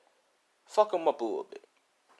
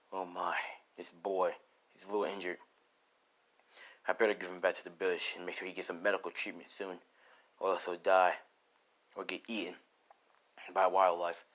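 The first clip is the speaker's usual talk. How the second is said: a lightly lowered stranger's voice; 5 semitones lower, 5 dB quieter than usual. Acted. Shadowy figure finds injured boy.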